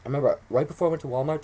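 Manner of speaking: ordinary talk, nothing marked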